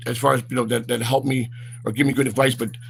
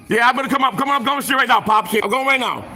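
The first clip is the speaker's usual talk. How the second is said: calling out; 9 semitones higher than usual. Real, not acted. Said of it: "I'm screaming".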